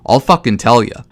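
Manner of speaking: usual speaking voice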